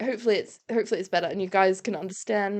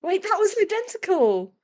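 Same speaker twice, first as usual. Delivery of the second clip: surprised; 10 semitones higher than usual. Real, not acted. Surprised reaction to own mimicry.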